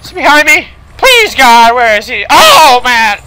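high, loud panicked cry. Real, not acted. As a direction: plead in rising panic, voice pitched high. Panicking as game enemy approaches.

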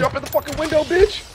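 high-pitched furious yell; raging gamer. Real, not acted. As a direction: scream at the enemy. Raging at enemy in game.

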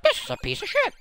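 a hint of a squeaky dismissive adventurer; mock the character's disdain; mock snooty voice. Mocking the adventurer who scorns a relic, mock squeaky voice. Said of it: "and you're like".

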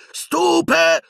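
very high yell, cut short; shouted spell. A narrator's reading. Harry trying to stun Piton, shouting. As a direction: burst in with an incantation. Harry casts mid-taunt.

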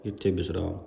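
ordinary delivery

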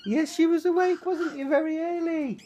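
high sing-song coo. Real, not acted. Cooing at the dog.

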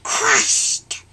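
loud, breathy burst; crash exclaim. A narrator's reading. Narrator acts the crash into the house, breathy burst. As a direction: blurt the crash sound.

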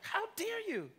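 a touch of hammed-up offence; mock outrage. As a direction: play-act being scandalised, laughing. Mock outrage at ignored text.

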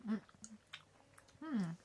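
chewing while talking; speaking around food; mouth full of chocolate. Real, not acted. Seen 1: Bar bitten just before; cheeks and lips show chewing while speaking.

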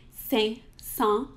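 Saint-Saëns with two nasal vowels; French Saint-Saëns. Shown as a demonstration. Teacher models the composer's name, nasal French. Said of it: "which is Saint-Saëns".

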